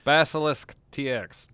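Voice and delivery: Basilisk T X said loud and slow, each letter hit; spelling the name out firmly at last; emphatic spelling. Shown as a demonstration. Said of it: "I just said their website".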